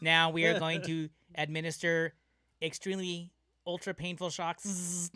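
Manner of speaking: loud, high robotic threat. Pretend. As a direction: announce it like a torture machine. Robot administering shocks.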